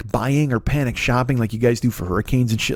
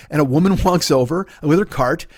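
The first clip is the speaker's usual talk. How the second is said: normal talking voice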